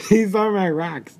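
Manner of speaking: said through laughter; laughing. Real, not acted. Player repeating the line while cracking up, laughing. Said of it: "laughing repeat".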